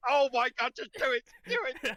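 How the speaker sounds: high, very loud begging; desperate excited dog. Acted. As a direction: give in completely and demand the belly rub. Dog gives in to belly rub.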